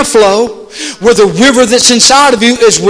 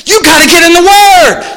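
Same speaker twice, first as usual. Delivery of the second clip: higher and louder, hammering it home; loud emphatic repeat; 8 semitones higher, 6 dB louder than usual. Acted. Pastor driving home the call to read the Bible, loud emphatic. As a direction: repeat it with a preacher's punch.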